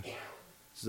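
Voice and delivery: ordinary talk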